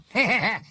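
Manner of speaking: high, harsh burst of laughter; a jeering, grating laugh. Acted. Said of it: "Malfoy éclata d'un rire grinçant".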